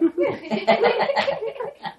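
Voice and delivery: a burst of high, loud laughter. Real, not acted. Storyteller laughing at the punchline.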